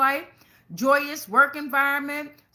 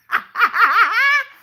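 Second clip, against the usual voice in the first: loud laugh; 11 semitones higher, 11 dB louder than usual. Real, not acted. Bursts out laughing after roar.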